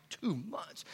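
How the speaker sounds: very soft, under the breath; hushed, dazed thought to yourself; stunned hush. Retold or imitated. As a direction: let it sink in, barely voiced. Recalls stunned thought at doctor's news.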